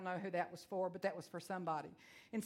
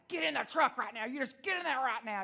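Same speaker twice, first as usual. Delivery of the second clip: angry mom; 3 semitones higher, 5 dB louder than usual. Retold or imitated. Mom scolding son.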